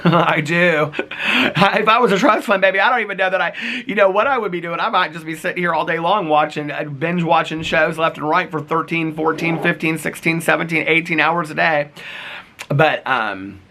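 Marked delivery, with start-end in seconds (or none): 0.0-11.9 laughing. Real, not acted. Joking about being trust fund baby.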